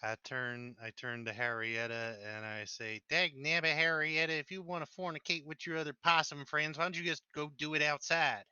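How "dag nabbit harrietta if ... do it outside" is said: loud hillbilly scolding. Acted. Character scolds possum in hillbilly voice.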